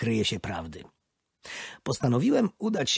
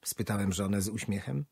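ordinary delivery, nothing marked

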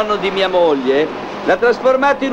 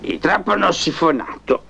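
sleep talking; 7 semitones lower than usual. Acted. Talks to himself in sleep, low.

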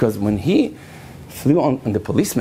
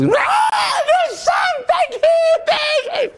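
mock panic scream; much slower than usual. Girl screaming when a cockroach lands on her shoulder, shrill mock panic. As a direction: shriek like a bug landed on you.

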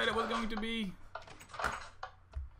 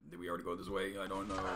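deep low voice; 13 semitones lower, 5 dB quieter, faster than usual. Pretend. Voiced lost character.

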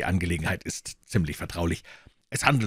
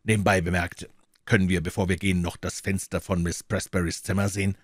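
ordinary delivery, nothing marked

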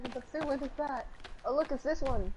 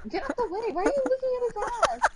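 mock jealous; 8 semitones higher, faster than usual.

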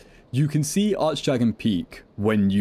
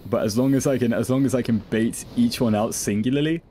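normal talking voice

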